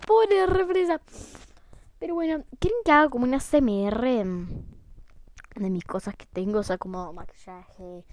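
ordinary talk

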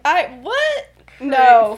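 mock startled stammer; flustered denial. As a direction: act caught out and deny it.

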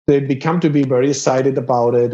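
ordinary delivery

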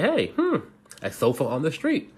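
a hint of a curious boy, pitch jumping up; boy wonders aloud at the sofa; lightly voiced boy. Boy on bike finds a sofa, lightly curious voice. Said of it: "said the boy".

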